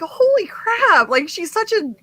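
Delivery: gushing over a newcomer; awestruck gush. Retold or imitated. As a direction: rave about her in disbelief. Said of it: "I was like".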